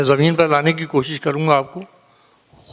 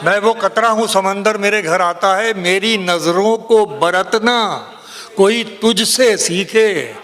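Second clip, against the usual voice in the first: higher, drawn-out ghazal declamation; poetry recitation; 6 semitones higher, slower than usual. Acted. Poet building a couplet for the mushaira audience, declaimed. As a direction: stretch the lines out and let them ring.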